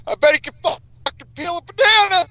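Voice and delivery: high-pitched mock outburst; exclaimed retold punchline. Loudly retelling his own joke punchline.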